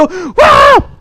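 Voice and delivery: high cheering yell; acted joyful shout. Teacher showing children shouting for joy, high mock cheer. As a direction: whoop as if delighted. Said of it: "기쁠 때도".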